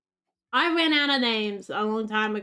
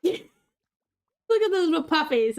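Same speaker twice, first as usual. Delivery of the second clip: high, slow laughing coo at the puppies; slow delighted laugh through the words; laughing fondly; 5 semitones higher, slower than usual. Real, not acted. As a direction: giggle over tiny puppies.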